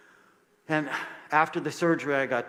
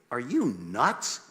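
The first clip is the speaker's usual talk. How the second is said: drawn-out, disbelieving question; slow incredulous challenge, sharply rising at the end; 4 dB louder, slower than usual. Retold or imitated. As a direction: stretch it out slowly in mock disbelief. Retold incredulous reaction to refusal.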